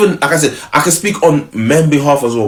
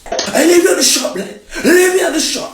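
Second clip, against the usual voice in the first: very high, laughing mimicry; mock her in a silly high squeak; squeaky mocking voice. Boyfriend mocking his girlfriend's words in a squeaky voice.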